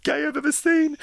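high, loud gushing superfan; gushing fan voice. Retold or imitated. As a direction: squeal it like an adoring fan. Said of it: "I'll get a message from someone saying".

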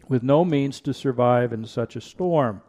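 ordinary delivery

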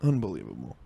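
low, soft grumble of disbelief. Acted. Comic sums up the fry story, low mock disbelief. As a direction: drop it low and quiet. Repeats word in two contrasting voices.

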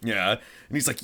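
usual speaking voice

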